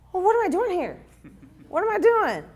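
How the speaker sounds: high, loud, incredulous outburst; surprised. Retold or imitated. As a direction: blurt it out in disbelief. Retold shock at recruiters leaving.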